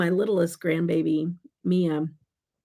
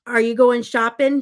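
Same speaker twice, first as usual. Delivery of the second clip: higher, louder playful question; raised, leading question; asking a toddler; 5 semitones higher, 8 dB louder than usual. Retold or imitated. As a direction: talk up to a little kid. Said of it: "and I said".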